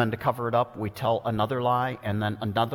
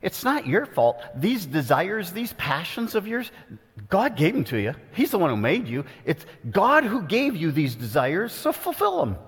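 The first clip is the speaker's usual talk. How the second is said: much higher, smooth tempter voice, climbing at the end; devil's voice; 7 semitones higher than usual. Acted. The devil excusing a listener's desires, high coaxing voice. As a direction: sweet-talk them like a seductive devil. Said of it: "heard the devil's voice saying to you".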